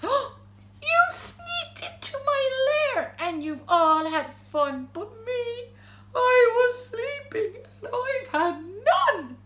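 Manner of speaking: loud, high, drawn-out complaint; wailing bear. A narrator's reading. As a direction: wail like a bear left out of the party. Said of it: "he whimpers and he moans and he wails".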